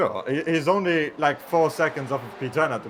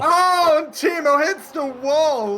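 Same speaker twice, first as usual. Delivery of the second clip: high, loud, slow commentator cry; mock commentator shout; 12 semitones higher, 6 dB louder, much slower than usual. Playing race commentator as Timo crashes, shouting. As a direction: shout it like a hammy race caller.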